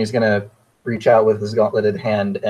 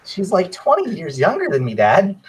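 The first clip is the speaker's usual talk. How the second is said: high-pitched, mortified objection; embarrassed protest; 9 semitones higher than usual. Acted. Son objects to his dad's assumption, embarrassed protest.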